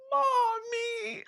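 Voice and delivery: put-on high, whimpering little-kid mommy; drunk crying for mommy. Drunk at the toilet calling her mom, mock whimper. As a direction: whine it like a drunk child. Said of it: "talking about".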